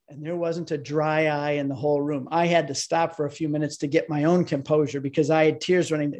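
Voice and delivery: ordinary talk, nothing marked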